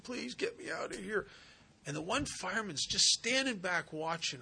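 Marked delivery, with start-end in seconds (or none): 0.0-1.3 put-on high, softer child's plea; pleading little girl. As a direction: beg like a scared little girl stuck fast. Trapped little girl in story.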